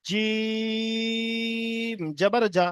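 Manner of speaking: each syllable held long and even; teacher stretching the letter for the class; drawn-out recitation. Shown as a demonstration. Teacher shows students the long drawn Quran recitation. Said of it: "জিম প্রথমে টান দিয়ে দেবো".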